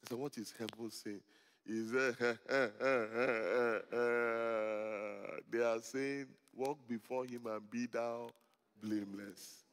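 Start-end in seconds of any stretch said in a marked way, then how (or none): 0.2-1.2 quiet, low mentor's question; imitating the mentor. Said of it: "That's the way he talked". 2.2-5.4 long, low stuttering "ehhh"; mentor's drawn-out hum. Retold or imitated. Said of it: "That's the way he talked". 5.5-9.6 low, measured mentor's pronouncement; imitating the mentor. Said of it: "That's the way he talked".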